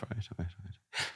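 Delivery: breathy resigned whisper; muttered giving in. Real, not acted. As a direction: murmur it away. Resigned aside.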